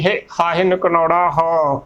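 ordinary talk

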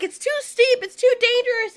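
worried Littlefoot. Retold or imitated. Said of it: "Littlefoot's like".

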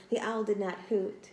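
usual speaking voice

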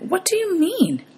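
high, drawn-out disbelief; baffled high-pitched question; bewildered demand. A narrator's reading. Hero startled by friend's revelation.

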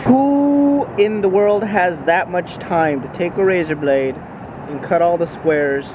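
high, loud, slow incredulous wonder; amazed disbelief. Real, not acted. Amazed vlogger marveling at someone's careful handiwork. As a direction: marvel out loud at the tiny cut squares.

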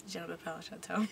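low, soft French introduction; hushed French accent. Shown as a demonstration. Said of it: "we haven't put down French".

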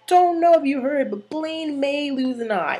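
high-pitched reenacted announcement, slowing at the end; Santana. Santana telling the Warblers about Blaine, high acted voice. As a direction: start high and punchy, then draw it out. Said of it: "She's like".

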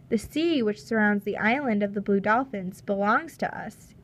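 usual speaking voice

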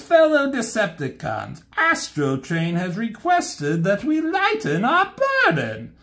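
high, smooth scheming robot voice; high-pitched conniving Decepticon lieutenant; Starscream voice. Retold or imitated. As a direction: purr it out like a sly second-in-command. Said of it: "He says".

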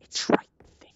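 scared whisper. Real, not acted. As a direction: whisper so the monster won't hear. Scared streamer whispers in game.

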